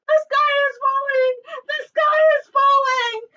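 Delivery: high panicked shouting. Acted. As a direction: shout the alarm.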